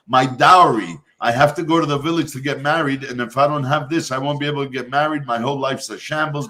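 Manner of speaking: ordinary talk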